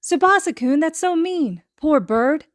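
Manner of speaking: put-on high, pained child protest; dismayed protest. A narrator's reading. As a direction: plead for the poor bird. Izuku scolding cruelty to bird.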